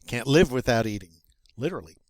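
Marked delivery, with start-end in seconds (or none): none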